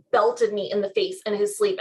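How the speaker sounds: usual speaking voice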